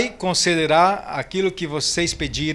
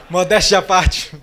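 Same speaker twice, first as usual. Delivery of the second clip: laughing; 4 dB louder than usual. Real, not acted. Joking about himself, laughing.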